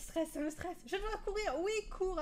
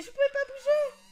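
laughing shriek; 12 semitones higher, 9 dB louder than usual. Real, not acted. Character frozen, player shrieks laughing.

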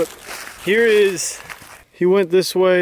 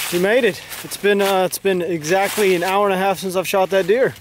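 normal talking voice

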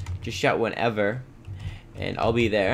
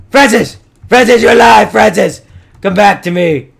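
loud, high relieved yell; overjoyed shouting; 9 semitones higher, 15 dB louder than usual. Real, not acted. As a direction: call out to the lost cow. Finds cow alive after blast.

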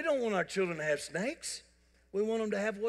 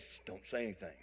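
hushed; 10 semitones lower, 14 dB quieter than usual. Retold or imitated. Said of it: "she looks at Blake and goes".

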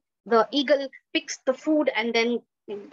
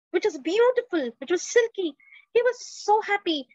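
much higher, lifting with delight; joyful wonder; 7 semitones higher than usual. A narrator's reading. Storyteller marvels at the eagle's new feathers, high and joyful. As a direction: let the joy soar on each word.

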